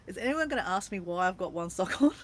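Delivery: ordinary talk, nothing marked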